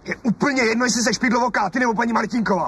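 high, heated husband's yell; the husband snapping at his wife over the neighbour; angry outburst. Acted. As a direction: lose your temper at her. Quarrel insult.

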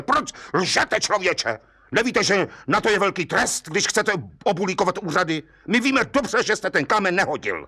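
high-pitched angry scolding; exclaiming judge. A narrator's reading. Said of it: "rozklikl se soudce".

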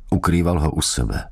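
ordinary delivery

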